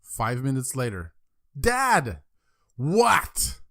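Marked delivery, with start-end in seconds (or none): 1.6-2.2 put-on loud, high-pitched child's call; kid yelling for dad. Small boy calls his father yet again, kid yelling. 2.8-3.6 loud, high, fed-up shout; exasperated dad. Acted. Father answers pestering son, exasperated shout. Father snaps third time.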